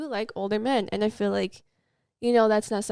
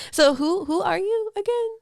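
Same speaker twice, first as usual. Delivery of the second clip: mock fan voice; 10 semitones higher, 5 dB louder than usual. Mocks fans' question.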